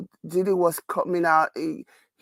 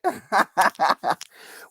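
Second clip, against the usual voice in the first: hearty laugh. Real, not acted. Teasing a co-host for missing the story, hearty laugh.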